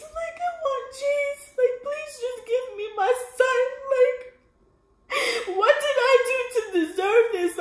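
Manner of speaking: high, sobbing plea to mom; crying plea. Retold or imitated. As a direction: beg your mother through tears, pitch way up. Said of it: "I'm just crying. I'm like".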